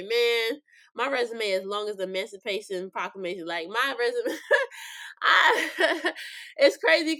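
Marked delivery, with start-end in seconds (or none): 4.4-7.2 high bursts of real laughter; laughing. Real, not acted. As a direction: laugh it out mid-sentence. Laughing at own joke.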